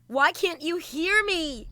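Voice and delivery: high, slow anguished cry; desperate plea. Acted. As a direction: plead as he ignores you. Desperate call to father.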